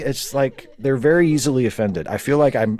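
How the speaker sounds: ordinary delivery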